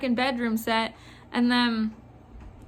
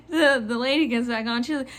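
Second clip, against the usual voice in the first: normal talking voice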